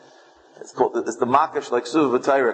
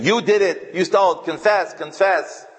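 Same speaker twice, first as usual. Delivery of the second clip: furious innkeeper shouting at a suspected thief; angry accusing shout; 8 semitones higher than usual. Retold or imitated. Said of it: "he started beating him and saying".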